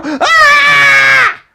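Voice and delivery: sudden loud scream right in their face. Shown as a demonstration. Job seeker screaming at the interviewer to break tension, loud yell. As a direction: blast a startled yell at the listener. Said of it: "Just go".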